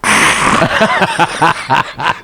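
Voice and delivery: high, loud refreshed ah; mock satisfied sigh. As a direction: gasp out like after a cold drink. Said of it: "she went".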